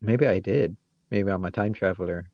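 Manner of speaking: ordinary talk, nothing marked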